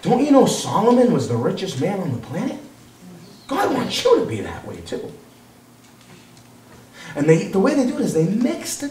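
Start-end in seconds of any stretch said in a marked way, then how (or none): 0.0-5.1 soft, lilting mock persuasion; coaxing false teacher. False teachers selling riches to believers, softly coaxing. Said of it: "Because that's what they do".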